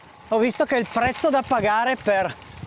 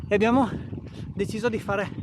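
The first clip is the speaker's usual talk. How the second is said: ordinary delivery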